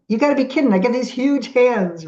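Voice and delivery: high, loud retold disbelief; incredulous protest. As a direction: protest as if it's absurd. Protesting the order to finger-paint.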